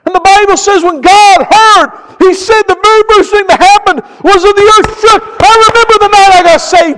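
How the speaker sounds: high, loud, building testimony; impassioned, hammering pulpit cadence; full-force preaching. Real, not acted. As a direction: proclaim it to the whole congregation.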